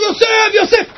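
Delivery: high-pitched retold cry; צעקה. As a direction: call out to Joseph high, without extra volume. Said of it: "והתחיל לצעוק". (קריאה גבוהה, צעקה מדומה)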